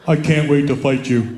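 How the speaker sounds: low, ominous mock threat; deadpan low threat; mock menace. Reads son's threat on mirror.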